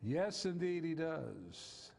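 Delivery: ordinary talk, nothing marked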